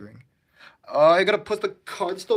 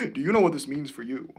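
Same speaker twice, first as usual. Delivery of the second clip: normal talking voice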